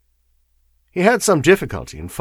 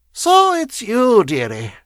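put-on high, wheezing old crone; wheezy voice; 13 semitones higher, 6 dB louder, slower than usual. A narrator's reading. Bent woman greeting the lawyer at her door, wheezy. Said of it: "she said in a wheezy voice".

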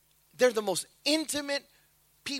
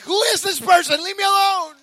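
mock upset child; 8 semitones higher, 7 dB louder than usual. Child upset at panicking parent.

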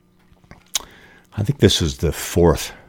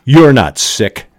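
cold retort; 6 semitones higher, 4 dB louder than usual. Acted. Daughter's cruel retort.

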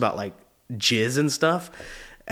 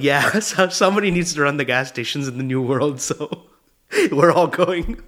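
higher, louder, breaking into laughter; laughing retelling; 3 semitones higher, 4 dB louder than usual. Real, not acted. Acting out dad's reason to family, cracking up. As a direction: crack up as you act out the line. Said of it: "Made me laugh so hard".

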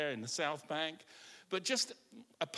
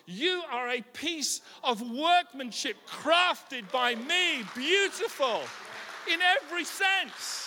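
higher, louder, slow proclaiming shout; God's proclamation; 7 semitones higher, 6 dB louder, slower than usual. Acted. Preacher voicing God praising his people, loud and high. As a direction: declare it over them with full force. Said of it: "God looks at you and he says".

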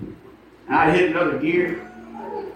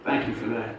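soft, lower, sheepish; humble apology; 4 semitones lower, 7 dB quieter than usual. Retold or imitated. Preacher apologizing to the driver he confronted, quiet and humble. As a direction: say it small and contrite.

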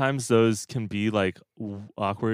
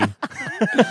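embarrassed laugh; 13 semitones higher, 4 dB louder than usual. Real, not acted. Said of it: "It's so bad".

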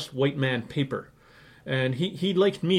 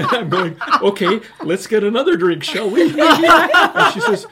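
laughing through the words, louder; 11 semitones higher, 11 dB louder than usual. Real, not acted. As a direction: laugh it out, amused. Laughing while retelling the Kristeva meeting.

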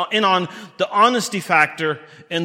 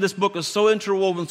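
ordinary delivery

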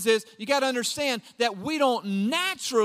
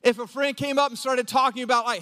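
ordinary talk

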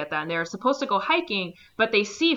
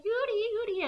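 much higher calling voice; mock girlfriend calling; 14 semitones higher, 3 dB quieter, much faster than usual. Girlfriend chasing the boxer, much higher calling voice. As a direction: call after him like a worried girlfriend.

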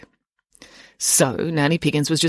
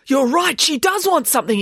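high, louder outburst; excited exclamation; 9 semitones higher, 4 dB louder than usual. A narrator's reading. Boy agreeing with his nanny, exclaiming. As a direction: exclaim a sudden realisation. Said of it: "exclaimed Michael".

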